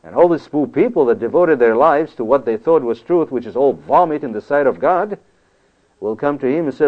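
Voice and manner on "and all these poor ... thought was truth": ordinary delivery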